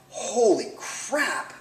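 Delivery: retold wide-eyed disbelief; amazed exclamation. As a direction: stretch each word out in awe. Retells his amazed first run.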